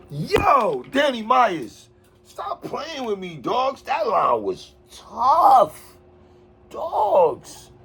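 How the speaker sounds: high, excited cry of disbelief; hyped outburst. Real, not acted. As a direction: let the line knock you back. Hyped reaction to a rap line.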